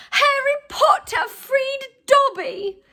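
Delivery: high, loud and slow, bursting with joy; shrill house-elf. A narrator's reading. Freed house-elf gazing up at Harry, shrill delight. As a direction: squeal it out like an overjoyed elf. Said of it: "said the elf shrilly".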